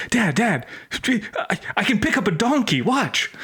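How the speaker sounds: put-on high, fast pleading kid voice; a desperate son begging his dad, high and eager. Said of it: "a desperate son".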